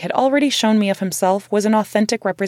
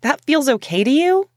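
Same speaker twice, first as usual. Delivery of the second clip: hounding; 7 semitones higher, 3 dB louder than usual. Retold or imitated. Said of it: "I hounded him".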